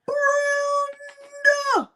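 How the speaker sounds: long, high sung-out name; drawn-out name call. Shown as a demonstration. Host greeting a viewer, long high sung-out name. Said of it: "I don't even wanna say her name like that".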